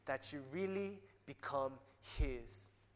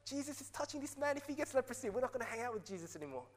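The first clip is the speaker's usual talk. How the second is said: alarmed disciples; 7 semitones higher, faster than usual. Retold or imitated. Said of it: "They were like".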